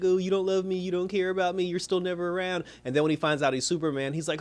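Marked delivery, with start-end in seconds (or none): 0.0-2.6 put-on pitched-up sulky kid complaint; mock the son's whining; whiny son. Son complaining dad is never around, mock whine.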